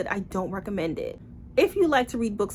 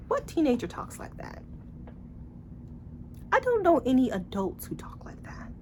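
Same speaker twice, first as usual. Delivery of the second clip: breathy aside in disbelief; incredulous whisper; 4 semitones higher, 5 dB quieter than usual. Real, not acted. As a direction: whisper it like you can't believe it. Mocking the book's dialogue.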